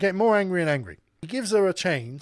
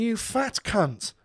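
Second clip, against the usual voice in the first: slow, emphatic retold insult, pitched up; lost temper; 5 semitones higher, much slower than usual. Storyteller looks at the rude customer and insults her, temper lost. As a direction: spell out the insult word by word. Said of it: "I just lost my temper".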